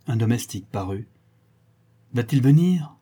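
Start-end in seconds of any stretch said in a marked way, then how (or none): 2.1-3.0 impatient call, louder and higher; shouted. A narrator's reading. The baron shouts for the tutor, impatient loud call. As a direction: bark the question across the room. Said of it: "cria le baron".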